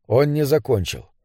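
usual speaking voice

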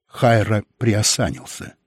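plain reading voice

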